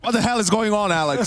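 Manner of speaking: disbelieving exclaim; incredulous. Real, not acted. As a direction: react in disbelief. Crazy round reaction.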